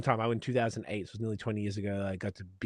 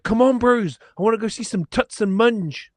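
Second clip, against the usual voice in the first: higher, louder imitation of a big Kiwi guy; Kiwi Maori accent; 11 semitones higher, 9 dB louder than usual. Imitating a Maori groomsman urging friends to the club. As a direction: boom it out like a cheerful Kiwi mate. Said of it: "Maori guys".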